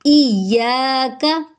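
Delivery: इय्याका slowly with a long या; correct recitation. Shown as a demonstration. Teacher shows the right इय्याका, slow and drawn out. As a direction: draw out the या in इय्याका. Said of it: "एक अलिफ के बराबर लंबा करेंगे".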